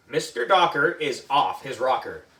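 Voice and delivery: plain reading voice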